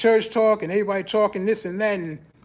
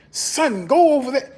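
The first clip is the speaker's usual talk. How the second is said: mock churchy father, pitched up, rising louder; church talk; 5 semitones higher, 4 dB louder than usual. Churchy parent talking to his kid, mock church talk. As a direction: order the child off in lightly hammed-up church talk. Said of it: "they got that church talk".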